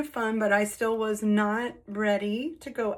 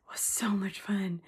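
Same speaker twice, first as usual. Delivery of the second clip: whisper; 5 dB quieter than usual. Real, not acted. Back home, telling viewers the night was fun, whispered. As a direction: whisper it like a secret.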